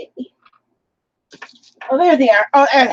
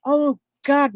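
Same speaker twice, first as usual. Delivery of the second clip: ordinary delivery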